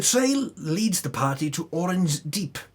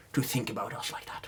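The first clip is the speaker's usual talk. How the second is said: sarcastic whisper; 5 semitones lower, 9 dB quieter than usual. Real, not acted. Sarcastic aside to Leonora.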